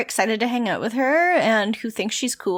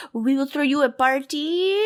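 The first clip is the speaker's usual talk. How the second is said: mock French accent; 2 semitones higher than usual. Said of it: "they're just like".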